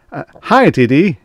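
high, loud, forced cheer; jovial director. A narrator's reading. Haggard director greets his boss, loudly jovial. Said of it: "despite the jovial smile".

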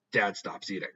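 ordinary delivery

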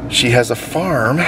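ordinary talk

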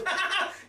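quick high laugh at the cold; a bright burst of laughter; short laugh. Real, not acted. Laugh after cold remark.

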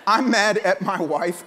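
ordinary delivery

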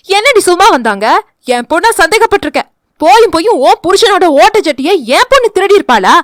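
high, loud, fast scolding auntie; angry shouting. Acted. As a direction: tear into her neighbour. Mother shouting in quarrel. (கோபமாக சண்டை போடும் அம்மா)

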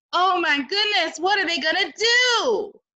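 alarmed excitement. Acted. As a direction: gasp it out to the kids.

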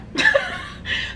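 high-pitched burst of laughter; high giggle fit; high laughing burst. Real, not acted. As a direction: let a squeal of laughter out. Laughs at own story.

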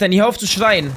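ordinary talk

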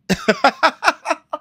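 a burst of high laughter. Real, not acted. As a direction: laugh out loud, high and free. Laughs at own joke.

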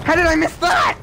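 high, loud shout of disbelief; loud, high shout of real disbelief; disbelieving shout. Real, not acted. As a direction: yell it in outrage at the missed shot. Repeats line, second time shouting.

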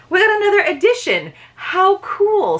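high, excited realization; thrilled surprise at a find; surprised. Real, not acted. Discovers a second book edition.

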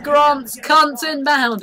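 high, loud, slow call; hammed-up warning; mock battle alert. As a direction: call it out word by word. Announces incoming enemies in game.

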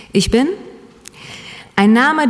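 usual speaking voice

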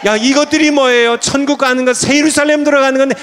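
high, loud pulpit exhortation; impassioned high-pitched sermon delivery; full-force preaching. Real, not acted.

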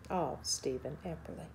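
slow, grave narration with deliberate pauses; documentary narration. Acted. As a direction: lay out the case, measured and serious. Narrates findings to camera.

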